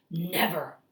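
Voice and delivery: usual speaking voice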